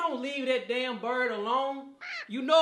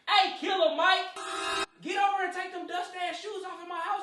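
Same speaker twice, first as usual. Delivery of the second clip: shouting scold; 6 semitones higher than usual. Acted. Host yells at friend.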